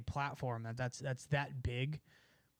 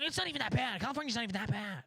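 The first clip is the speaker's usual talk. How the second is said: mock defensive pleading; 7 semitones higher, faster than usual. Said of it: "And it's like".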